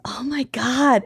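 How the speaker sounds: unvoiced whisper. Real, not acted. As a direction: breathe it out in a whisper. Whispered reaction.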